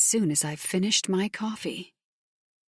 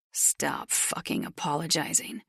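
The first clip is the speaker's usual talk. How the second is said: gruff male voice; 2 semitones lower, 6 dB quieter than usual. Acted. Gruff biker snaps at narrator.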